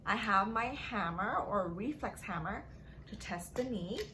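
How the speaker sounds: ordinary delivery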